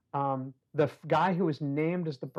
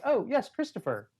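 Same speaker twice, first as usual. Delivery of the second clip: put-on child teacher; 8 semitones higher than usual. Child calls on imaginary pupil.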